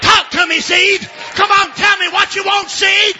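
high, loud calling out; pleading shout; shouted plea. Acted. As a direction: call out to the seed.

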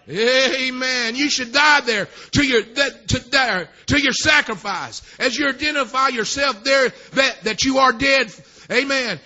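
forceful, shouted exhortation in bursts; impassioned preaching. Real, not acted. Preacher urging congregation to die at the altar, impassioned. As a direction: preach it hard, punching each phrase.

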